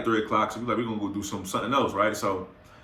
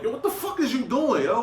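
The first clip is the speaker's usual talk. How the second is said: high incredulous yell; 12 semitones higher than usual. Retold or imitated. Snatching drunk friend's phone.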